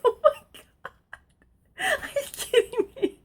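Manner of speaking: very high, very slow disbelief; stunned disbelief. Retold or imitated. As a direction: stretch it out, can't believe it. Crab trap pulled up instead.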